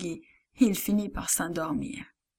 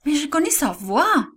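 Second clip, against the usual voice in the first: high, louder protest; insisting; 6 semitones higher, 4 dB louder than usual. A narrator's reading. Servant girl defending what she heard, high insistent protest. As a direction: she won't be doubted. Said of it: "dit Rhodes".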